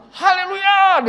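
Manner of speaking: very high, drawn-out shout of praise; loud shout. Retold or imitated. Old pastor hears Jesus is coming, shouts jubilantly. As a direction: belt it out at full voice. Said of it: "Dia teriak kencang sekali".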